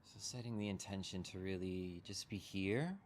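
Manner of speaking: unhurried meditation guide, long pauses between phrases; guided meditation. Real, not acted. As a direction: guide them gently into settling, leaving room to breathe.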